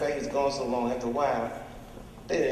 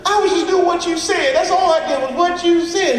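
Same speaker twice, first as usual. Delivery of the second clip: high, loud desperate woman's voice; protesting girl; 9 dB louder than usual. Acted. Bottom girl protesting to Iceberg, high and loud. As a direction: cry out in her defence, pitched way up.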